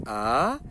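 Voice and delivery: "a" starting low and sliding up; rising tone. Shown as a demonstration. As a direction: sweep "a" upward like a question. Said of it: "a segunda que sobe".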